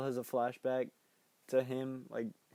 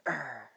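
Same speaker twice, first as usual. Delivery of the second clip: short imitated monster growl. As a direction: growl like a movie monster, briefly. Mimics Pennywise's snarl in retelling.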